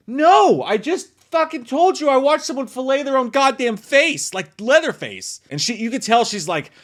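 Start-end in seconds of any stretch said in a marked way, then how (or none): 0.1-5.4 mock outraged shout, much higher and louder; furious yelled retort. Woman yelling at her clueless boyfriend, furious high shout. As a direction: hurl it back at him like she's had enough. She snaps back at boyfriend.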